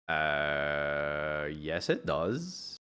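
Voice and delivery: slow, low deadpan correction; dry correction. Real, not acted. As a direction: drag it out, unimpressed.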